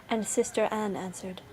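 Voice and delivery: plain reading voice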